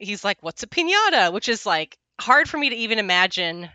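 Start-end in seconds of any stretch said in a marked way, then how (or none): 0.4-1.3 higher, louder quote of a baffled character; Clint character's puzzled question. Retold or imitated. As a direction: voice the clueless question, pitched up. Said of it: "the Clint character even has a line".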